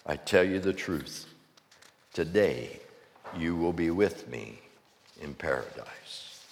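soft, low promise to a dying man; quiet reassurance. A narrator's reading. Jesus answers the dying criminal.

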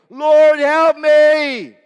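full-voiced screaming plea for help; desperate scream. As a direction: scream for help as you sink. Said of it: "Do you think that was a".

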